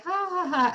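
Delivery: high-pitched pretend laugh; mock laugh. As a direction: imitate a carefree ha-ha-ha in passing. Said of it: "you're laughing".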